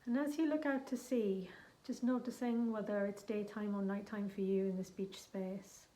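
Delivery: gentle guided visualisation; guided meditation. Acted. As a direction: ease the listener into the journey, unhurried. Leads a guided shamanic journey.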